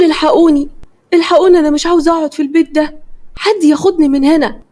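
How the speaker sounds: high-pitched, understated narrated scream; frightened cry for help. A narrator's reading. Terrified Sama begs to be taken out of the house, mild scream. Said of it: "سما فضلت تصرخ".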